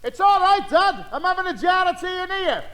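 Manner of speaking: high, carrying shout to Dad; calling out. Acted. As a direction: call it out through the window.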